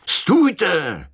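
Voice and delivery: sharp high call to stop; a soldier's sudden challenge, shouted high; shouted halt. A narrator's reading. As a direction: yell at the stranger to stop. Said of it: "křikl na mě".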